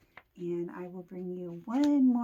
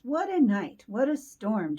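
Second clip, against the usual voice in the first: chirpy bird voice; 7 dB louder than usual. Acted. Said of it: "tweeters Wren".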